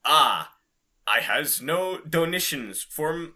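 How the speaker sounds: ordinary talk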